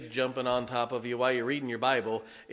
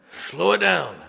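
self scolding; 5 semitones higher, 9 dB louder than usual. Retold or imitated. Preacher scolding himself to slow.

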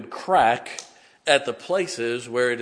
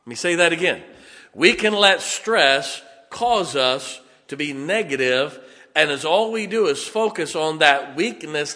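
loud, building pulpit delivery; preaching; 4 semitones higher, 7 dB louder than usual. Acted. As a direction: drive the point home to the congregation. Preaching at full force.

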